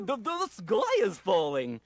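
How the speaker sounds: a slow, dopey beaver grumbling; slow dim beaver. Acted.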